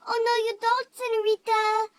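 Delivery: hammed-up kid yelling a dare; mock defiant shout. Kid acts out playground standoff.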